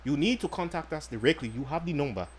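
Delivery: put-on lightly held African accent, steady and formal. Host promises home delivery to listeners, light mock African accent. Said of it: "You sound African right now".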